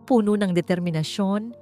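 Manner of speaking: plain reading voice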